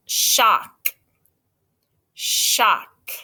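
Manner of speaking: "shock" said slowly and clearly; dictating a word. Shown as a demonstration. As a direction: say "shock" slow and clear for spelling. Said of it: "the word".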